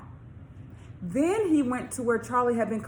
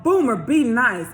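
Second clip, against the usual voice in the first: a louder storybook yell, slowed; mock yell; 4 semitones higher, 9 dB louder than usual. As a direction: shout it across the playground, slowly. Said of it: "The boys yelled".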